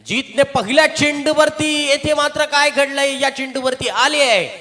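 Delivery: loud high shout, stretched OUT; excited wicket call. Real, not acted. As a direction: roar the dismissal, drawing out the letters. Wicket on the first ball. (उत्साहात ओरडून बाद घोषणा)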